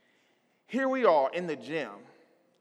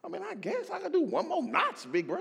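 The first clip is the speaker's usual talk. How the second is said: high, soft, hesitant squeak; timid pushover voice; reluctant agreement; 10 semitones higher, 7 dB quieter than usual. Retold or imitated. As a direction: agree nervously to the bigger guy. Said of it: "I'm like".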